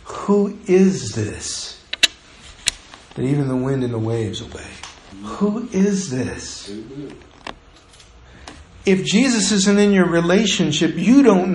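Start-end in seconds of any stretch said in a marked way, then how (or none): 0.0-6.7 awed whisper-soft question. Retold or imitated. Stunned disciples asking each other, hushed and awed. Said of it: "they asked each other".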